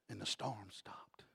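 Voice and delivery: a sudden hush, slow and breathless; whispered. Acted. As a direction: drop to a whisper to let it land. Dramatic hush after the climax.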